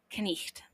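"knecht" with the K sounded before the N; slow "knecht", both opening consonants pronounced; Old English pronunciation. Shown as a demonstration. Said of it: "this is a consonant cluster".

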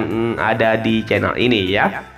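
ordinary talk, nothing marked

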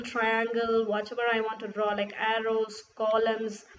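ordinary talk, nothing marked

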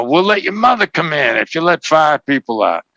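a mock movie bargaining offer; movie negotiator line. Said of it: "where Eddie Murphy says".

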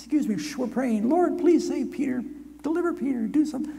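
put-on high girl's voice, shushing then pleading; Rhoda hushing, praying. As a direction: hush the man, then beg the Lord. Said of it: "she says".

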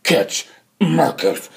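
very slow, halting zombie voice. Acted. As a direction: drag each word out like the undead. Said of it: "he's like a zombie".

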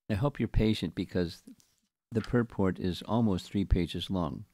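ordinary talk, nothing marked

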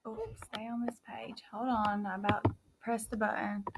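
very soft, half-whispered aside; hushed aside. Real, not acted. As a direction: keep it under your breath, off to the side. Hushed aside to child helper.

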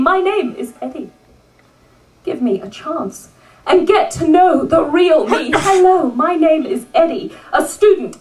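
earnest, insistent poetry recital; rhythmic recital with rising refrains; spoken-word poem reading. Acted. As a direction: read it as a prisoner's plea to be known. Reads Eddie's poem aloud.

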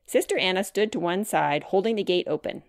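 ordinary delivery, nothing marked

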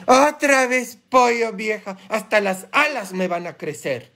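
exaggerated high-pitched grumble; grumbling husband. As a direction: imitate a husband whining about dinner. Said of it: "¿y qué dices?".

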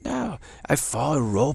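ordinary talk

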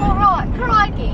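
slow, high mock Aussie crikey; Australian accent. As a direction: play a jokey Australian. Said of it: "stop talking in accents".

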